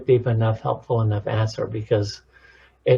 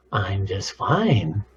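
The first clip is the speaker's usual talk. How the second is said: slow, lower, with "fine" swooping up then down; stress on fine; 4 semitones lower, 3 dB quieter, much slower than usual. Shown as a demonstration. Showing different tones on one answer, "fine" swooped. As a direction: swoop the pitch over a long "fine". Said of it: "Those different tones of voices".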